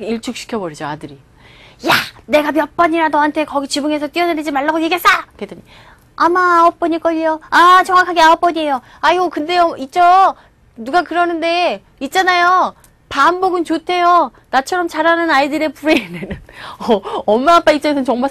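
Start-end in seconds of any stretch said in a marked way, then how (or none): 1.9-5.1 fast mock-angry telling-off; scolding mother. Mother scolding son about jumping off roof, acted angry. 6.2-16.4 higher-pitched little know-it-all voice; cheeky child. Acted. Son answering back to scolding mother, cheeky child voice.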